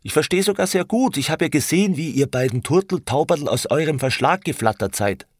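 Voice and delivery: high, agitated jealous outburst; heated exclamation. A narrator's reading. Franz accuses the two turtledoves, exclaiming in jealousy. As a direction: pour out the accusation, pitched high. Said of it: "rief Franz aus".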